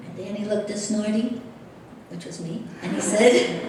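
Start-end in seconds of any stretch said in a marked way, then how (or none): none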